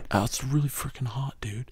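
hushed burnout dude; stoner voice. Pretend. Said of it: "You get a pretty good stoner voice".

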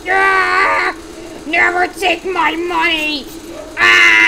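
high, loud, drawn-out screaming; hammed-up furious war cry; battle yell. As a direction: scream it as you attack, in play. Character yells while shooting robber.

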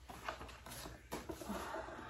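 long breathy sigh, unvoiced; heavy sigh. Real, not acted. Overcome by a gift letter, a long sigh. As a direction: let out a long shaky sigh. Overwhelmed by gifts.

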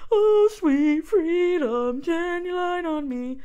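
high, slow crooning soul singer. Retold or imitated. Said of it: "my [name] impression".

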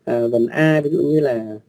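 ordinary talk, nothing marked

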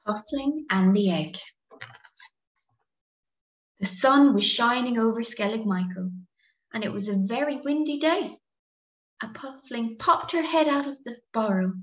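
lively picture-book narration, swooping pitch; sing-song storyteller with stretched key words; story reading to children. A narrator's reading. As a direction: read it animatedly to little listeners.

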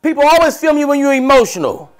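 high, loud comic indignation; mock outrage. Real, not acted. Comedian to friend about being filmed, high loud mock indignation. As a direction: act wronged and worked up.

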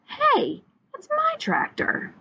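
high-pitched, startled protest; indignant realization. A narrator's reading. Farmer spotting his own tractor, indignant. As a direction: leap up high, drawing out the words.

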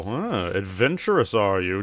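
ordinary talk

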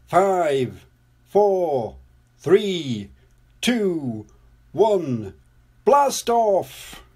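spaced countdown rising to a loud blast-off; launch countdown. A narrator's reading. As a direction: count down to lift-off, calling the last words high.

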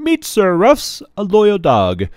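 high, loud, grand announcing; formal little girl. Acted. Jane introducing Leo to her court, grandly. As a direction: introduce him like a herald.

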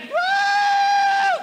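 high, loud whooping cry; joyful whoop. Real, not acted. Preacher whooping in delight at the verse. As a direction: let out a big whoop of joy.

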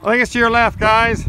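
loud call to friends ahead. Real, not acted. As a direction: shout the directions across to them. Calling directions to the group.

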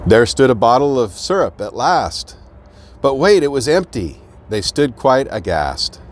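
lively rhyming storybook read; bouncy picture-book narration; reading to children. A narrator's reading. Reads picture book aloud.

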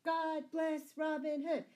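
high, loud shouted acclaim; crowd's cheer. A narrator's reading. Townsfolk cheering the departing outlaws, shouting. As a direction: cheer the hero with full voice. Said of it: "shouted the crowd".

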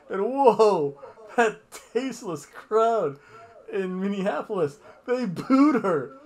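high, loud sarcastic disbelief; mock outrage. Fan mocking the crowd for booing her, high and loud. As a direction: play up the outrage, laughing through it.

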